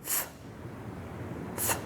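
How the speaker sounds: th said with tongue between teeth; th sound demo. Shown as a demonstration. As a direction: push air through the th slowly. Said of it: "So here we have".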